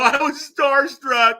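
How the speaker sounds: very high, loud drawn-out wail; starstruck protest. Retold or imitated. Fan explaining himself to the cafe owner, starstruck wail. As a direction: plead your case in a slow, high cry. Defends himself after gushing at star.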